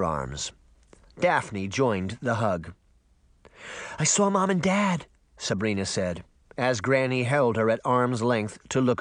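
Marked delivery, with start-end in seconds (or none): 4.0-5.1 put-on higher-pitched, urgent young girl; breathless girl reporting. A narrator's reading. Girl tells Granny she saw her parents, urgent. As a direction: blurt out the news in a higher girlish voice.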